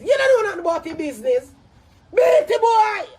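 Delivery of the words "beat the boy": loud, high, furious command; explosive raised-voice order; angry shout. Real, not acted. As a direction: shout the order at full force. Angry rant ordering a beating.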